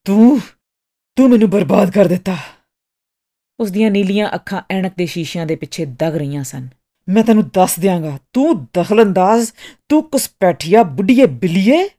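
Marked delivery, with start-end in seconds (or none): 0.0-2.4 loud, furious accusation; yelling. A narrator's reading. Customer pounding Miss Martha's counter, yelling in fury. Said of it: "ਮੁੱਕੇ ਮਾਰਦਿਆਂ ਚੀਖਿਆ". 7.1-11.8 loud, emphatic angry name-calling; furious yelling. A narrator's reading. Ruined artist raging at Miss Martha, loud and furious. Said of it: "ਮੁੱਕੇ ਮਾਰਦਿਆਂ ਚੀਖਿਆ".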